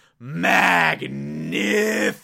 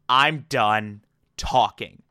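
mock intimidating; 6 semitones lower, slower than usual. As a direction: lower and slow, a lightly voiced menacing cutoff. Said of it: "adopts a very intimidating stance".

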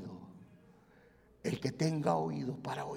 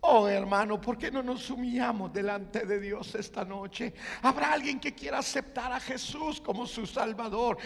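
loud, high shouted altar call; preaching at full force; 11 semitones higher, 14 dB louder than usual. Acted. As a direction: call them forward with fervour.

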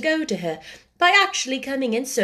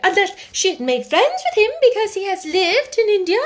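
much higher, flustered little voice; flustered sister; 11 semitones higher, 6 dB louder than usual. Acted. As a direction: dither nervously to your sister. Miss Amelia speaks.

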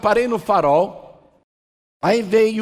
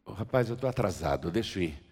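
softer, lower, hurried-passerby excuse; low-key brush-off; 9 semitones lower, 8 dB quieter than usual. Acted. Passerby putting off a begging man, low-key brush-off. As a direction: excuse yourself quietly and low.

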